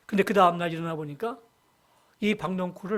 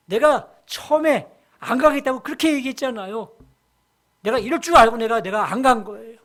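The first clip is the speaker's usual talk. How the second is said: louder, heated protest to God; Jonah's indignant complaint; 3 semitones higher, 7 dB louder than usual. Acted. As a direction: argue back like a sulking servant. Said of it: "따지는 거예요".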